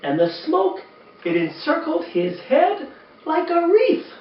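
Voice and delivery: lively, sing-song storybook reading; reading to children. A narrator's reading. Uncle Mitch reading a Christmas poem to kids, animated storytelling. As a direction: read it like bedtime story time for kids.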